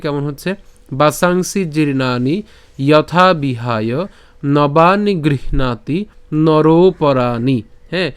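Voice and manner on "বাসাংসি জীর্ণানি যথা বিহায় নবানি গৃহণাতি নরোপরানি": slow, measured verse reading; pronunciation demo. Shown as a demonstration. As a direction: draw out every syllable from বাসাংসি to নরোপরানি. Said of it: "যদি প্রথম থেকে বলি". (ধীরে টেনে শ্লোকের স্পষ্ট উচ্চারণ)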